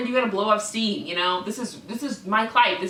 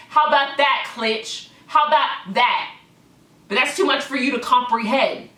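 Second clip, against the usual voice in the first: really letting it rip; heated rant; 3 semitones higher than usual. Real, not acted. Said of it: "I'm actually getting heated".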